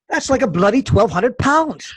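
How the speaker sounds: put-on a weak try at a British accent; English accent. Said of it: "Interesting English accent".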